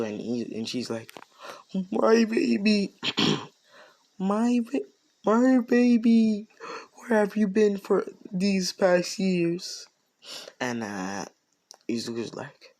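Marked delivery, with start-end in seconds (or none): 1.9-9.9 high, slow, loud motherly wailing; hammed-up emotional reunion cry, drawn out high; mock-tearful mother. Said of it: "And Inko cries too".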